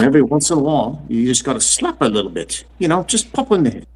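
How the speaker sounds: lightly voiced low Scottish film star; a hint of a slurred Scottish star; Sean Connery. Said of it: "Yeah, Sean Connery".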